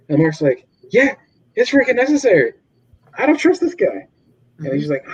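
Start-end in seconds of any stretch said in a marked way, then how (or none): 0.9-4.1 quick, higher mock Mark; hammed-up insistent hero; voicing Mark. Mark voiced in retelling.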